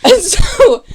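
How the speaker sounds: high, loud words through a laugh. Real, not acted. Recapper amused by own best-friends line, laughing. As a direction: break up laughing as you carry on.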